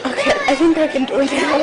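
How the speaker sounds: high, choked, recovering voice; teary. Real, not acted. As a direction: pull yourself together through tears. Honoree recovering from tears.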